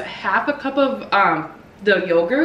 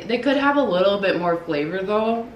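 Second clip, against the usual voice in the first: normal talking voice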